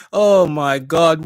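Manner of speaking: stunned laugh. Real, not acted. Reacting to shocking news.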